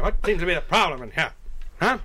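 lightly voiced 1930s beat cop; a hint of a swaggering beat cop; old-timey cop. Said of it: "old-timey cop".

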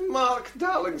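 high, loud imitated Scottish accent. Singer greeting him at his table, mock Scottish accent. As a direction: put on a Scottish accent, pitched right up. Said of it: "Scottish accent".

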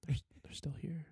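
conspiratorial whisper. Pretend. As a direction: whisper like a secret. Pretends the listeners stayed.

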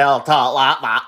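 lightly exaggerated Yorkshire accent; mock Yorkshire accent. Londoner teasing how northerners talk, mock Yorkshire accent. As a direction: put on a rough Yorkshire impression. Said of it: "they're not from Yorkshire".

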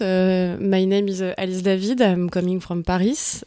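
heavily French-accented English; self-mocking bad English in a French accent. Shown as a demonstration. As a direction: speak halting English with a strong French accent. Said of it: "I have a really bad, euh, English accent".

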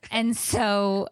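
ordinary talk

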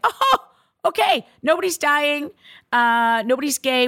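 high, loud burst of relief; giddy relieved squeal; relieved excitement. Retold or imitated. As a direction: let the relief spill out high. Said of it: "it was like a relief".

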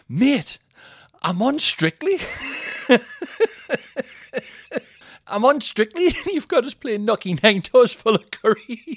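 high, loud, laughing through it; quoting Ramsey while cracking up. Retold or imitated. Retelling Ramsey's complaint, laughing hard. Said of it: "He went".

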